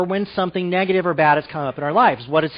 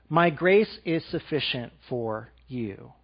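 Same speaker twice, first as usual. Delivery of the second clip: softer, slower, lower reading; soft slow voice of God, lower; tender divine voice; 5 semitones lower, 5 dB quieter, slower than usual. Acted. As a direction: let God's words land gently. Reading God's words.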